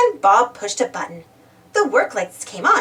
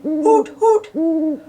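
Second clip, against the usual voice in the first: higher, hollow owl call; owl hoot; 5 semitones higher, 4 dB louder than usual. A narrator's reading. Owl heard inside the dark tunnel, hooting. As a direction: imitate an owl hooting twice. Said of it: "they heard a strange noise".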